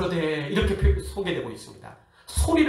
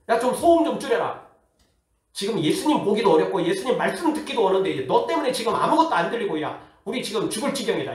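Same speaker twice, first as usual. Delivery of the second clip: higher-pitched, exasperated mock rebuke; acted scolding; 4 semitones higher than usual. Preacher acting the crowd who cannot hear Jesus, scolding. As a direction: complain indignantly, playing the fed-up crowd. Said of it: "사람들이 막 꾸짖습니다".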